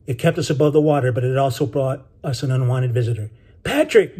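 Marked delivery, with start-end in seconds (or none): 3.6-4.2 high calling shout, moderately loud. A narrator's reading. Benny calls to the boy from behind, shouting. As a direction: call the name out high. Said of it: "Benny shouted again".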